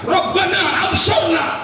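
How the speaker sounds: high, loud, slow anguished cry; wailing plea. Acted. Damned souls in hell crying to God, loud and high. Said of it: "mereka menangis".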